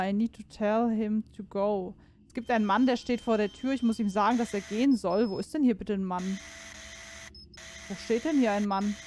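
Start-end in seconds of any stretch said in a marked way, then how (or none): none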